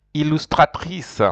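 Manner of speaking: "Illustratrice" said in French, with a clear French R; French model word. Shown as a demonstration. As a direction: model "Illustratrice" for the class to repeat.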